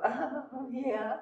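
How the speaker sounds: high-pitched, giggly yeah; mock an actor's flustered answer, laughing high; laughing reply. Actress answers the director's odd note, high and laughing. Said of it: "And you kinda go".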